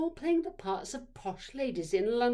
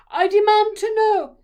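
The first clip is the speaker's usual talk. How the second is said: very high, very loud shout; explosive, imperious yell; furious demand; 12 semitones higher, 15 dB louder than usual. A narrator's reading. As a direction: bellow it like an outraged mother demanding answers. Angry mother demanding the culprits.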